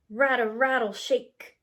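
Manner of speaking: ordinary delivery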